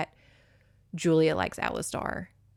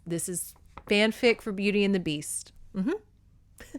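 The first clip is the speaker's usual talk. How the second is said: ordinary delivery, nothing marked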